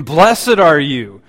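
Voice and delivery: loud, slow, lifted proclamation; exclamation. Shown as a demonstration. Angel greeting Mary with excited exclamation. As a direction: proclaim it with wonder, full voice. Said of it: "with, with exclamation".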